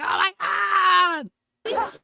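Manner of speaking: high-pitched mock squeal of reunion; mock excited scream. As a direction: squeal it out like long-lost friends bunking together. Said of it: "and they're like".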